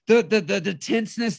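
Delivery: ordinary talk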